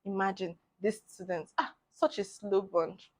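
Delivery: low, soft scornful muttering; disparaging mock lecturer. Lecturers talking against a student, low disparaging mutter. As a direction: imitate someone running a student down under their breath.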